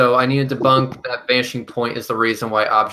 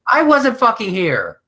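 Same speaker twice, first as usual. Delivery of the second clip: exasperated; 10 semitones higher, 3 dB louder, slower than usual. Real, not acted. Protests he missed that episode.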